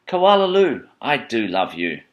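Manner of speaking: fond mother. Acted. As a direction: sing out the name, then slow down fondly. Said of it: "her mother would fling her arms around".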